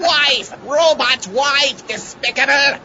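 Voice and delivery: indignant shout. Acted. Robot maker offended, shouting.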